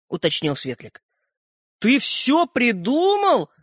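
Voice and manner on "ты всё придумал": slow, high, outraged question; a boy catching a liar out; indignant. A narrator's reading. Rodka to the little man, indignant and slow. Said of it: "возмущённо подхватил Родька".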